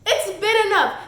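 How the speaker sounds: scoffing laugh as it spills out; laughing disbelief. Real, not acted. Mocking the hero's timing, loud laughing disbelief. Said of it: "oh, it's enough".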